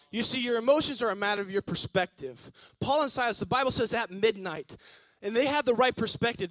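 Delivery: loud, rising preacher's build; preaching. Acted. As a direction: preach it out to the church. Pastor preaching at full force.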